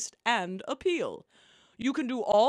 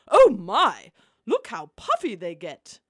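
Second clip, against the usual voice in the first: surprised; 4 semitones lower, 7 dB louder than usual. Acted. Watching peeps puff up.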